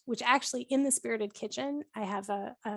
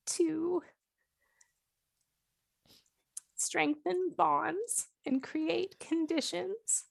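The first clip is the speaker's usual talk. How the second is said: higher, slower, tearful wonder; choked-up tenderness; 5 semitones higher than usual. Retold or imitated. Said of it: "I did get, like, choked up".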